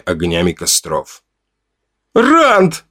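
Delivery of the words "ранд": high piercing call of a son's name; frantic mother crying out her son's name; mother's cry. Acted. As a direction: scream his name across the camp. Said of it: "Этот крик разорвал воздух".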